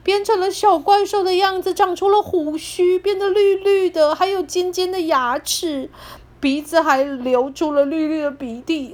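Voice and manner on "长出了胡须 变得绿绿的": usual speaking voice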